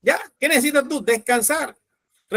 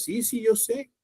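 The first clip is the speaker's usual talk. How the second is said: calm knowing reply; 7 dB quieter, slower than usual. Pretend. Jesus answers calmly.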